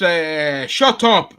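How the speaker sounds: high, loud outburst imitating the Doctor; imitated outburst. Showing the panel how the Doctor snapped, loud imitated shout. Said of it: "like that".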